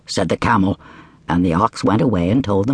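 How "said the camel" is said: usual speaking voice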